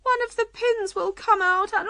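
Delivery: high-pitched unhappy Queen. A narrator's reading. Queen foretelling the pin coming out, unhappy. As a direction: fret towards an outcry. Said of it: "the Queen said unhappily".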